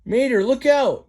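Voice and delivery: high, loud urgent yell; yelled warning. Acted. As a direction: shout the warning across the field. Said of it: "yelled Luke".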